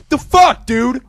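high, loud indignant yell; loud, indignant disbelief; shocked outburst. Retold or imitated. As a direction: blurt it out high and loud in outrage. Acting out his angry reaction.

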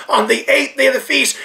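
ordinary talk, nothing marked

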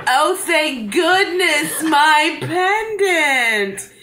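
high, slow, drawn-out relief; mock relieved lady. Voicing the woman getting her pendant, high and slow. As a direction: stretch it out like a swooning lady.

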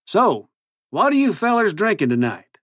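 high-pitched folksy cowboy twang; cowboy accent. A narrator's reading. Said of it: "his ridiculous new accent".

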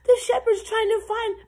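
high, loud rush of hope; excited realization. A narrator's reading. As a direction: tell it thrilled that rescue is coming. Lamb realizes rescue is coming.

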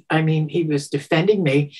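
ordinary delivery

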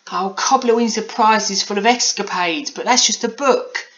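put-on high, loud girlish grumble; Polly complaining. A narrator's reading. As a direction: complain in a high-pitched child's voice. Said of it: "she complained to herself".

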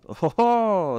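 high, loud jolly call. A narrator's reading. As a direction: hail someone with a hearty whoop. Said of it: "zvolal trubadur". (vesele zvolat)

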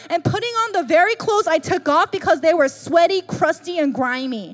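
high, loud, urgent sermon voice; full-force preaching. Acted. Pastor urging believers to drop grave clothes, preaching hard. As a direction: drive the point home to the pews.

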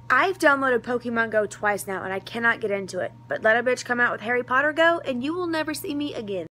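ordinary talk, nothing marked

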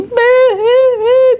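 high, slow hammed-up boo-hoo; high, drawn-out pretend boo-hoo sob; mock sobbing boo-hoo. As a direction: fake a big theatrical cry. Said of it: "cried Bird".

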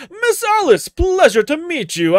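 very high, loud mock gentleman; voiced greeting. Gamer voicing a character greeting Alice, high and loud. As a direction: greet her like a chivalrous knight.

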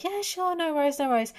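high, overly chipper reassurance; fake cheery politeness. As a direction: beam it out like nothing's wrong. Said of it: "externally, I was like".

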